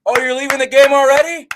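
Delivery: loud, high taunting jeer; sarcastic stadium heckler; mocking heckle. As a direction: rub it in like a smug fan. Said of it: "starts clapping and goes".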